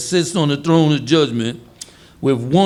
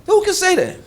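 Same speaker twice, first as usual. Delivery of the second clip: emphatic high call; 13 semitones higher, 5 dB louder than usual. Real, not acted. Preacher builds to a peak.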